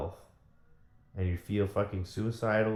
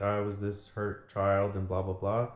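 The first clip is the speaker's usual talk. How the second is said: ordinary talk, nothing marked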